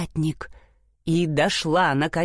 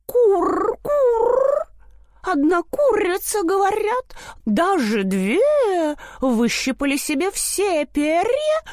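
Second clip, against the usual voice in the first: cooing pigeon voice; 11 semitones higher than usual. A narrator's reading. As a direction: coo the gossip up high. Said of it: "заворковали сидящим внизу курам".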